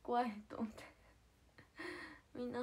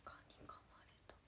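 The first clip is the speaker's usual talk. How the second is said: breathy voiceless murmur to herself; whispered aside; 15 dB quieter, faster than usual. Real, not acted. Streamer noting a mosquito bite, whispered to herself. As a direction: mutter it under your breath.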